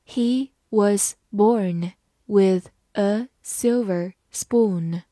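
slow, isolated words for learners; slow reading. Shown as a demonstration. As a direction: say each word separately, with pauses. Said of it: "慢慢来读".

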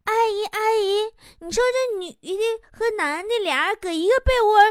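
put-on high-pitched little boy's question; five-year-old boy. Five-year-old asking his dad's friend a question, high little-boy voice. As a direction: pitch it up like a curious small child. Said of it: "他五岁的儿子悄悄跑到我身边问".

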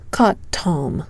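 ordinary delivery

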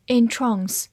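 British "entrance", verb, stressed on the second syllable with a long ah; British English in-TRAHNS, stress at the end; British pronunciation. Shown as a demonstration. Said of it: "英式发音读作".

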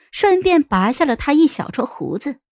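ordinary delivery

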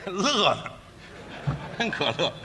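laughing through the words; laugh that resembles crying. Acted. Straight man denying he cried, laughing like someone weeping. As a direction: claim amusement with a sorry-sounding laugh. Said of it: "这乐怎么比哭还难看".

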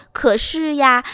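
ordinary delivery

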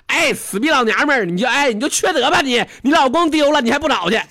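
loud mock child scolding his mother; bratty little boy shouting at his mom; kid yelling at mom. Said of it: "回来就冲他妈就喊了". (小孩冲妈妈高声嚷嚷)

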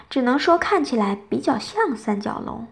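usual speaking voice

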